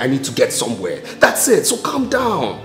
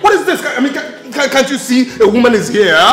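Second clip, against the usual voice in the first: high, loud, fast rant; indignant, spluttering outburst; angry shouting; 9 semitones higher, 5 dB louder, faster than usual. Acted. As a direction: rant at the intruder at high pitch. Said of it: "You can now shout".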